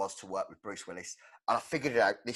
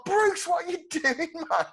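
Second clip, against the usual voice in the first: much higher, laughing through the question; laughing disbelief; 5 dB louder than usual. Retold or imitated. Host laughing at Bruce's rudeness to the interviewer, disbelieving. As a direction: crack up mid-question in mock dismay. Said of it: "And you're like".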